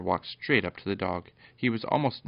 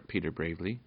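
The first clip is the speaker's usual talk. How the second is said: usual speaking voice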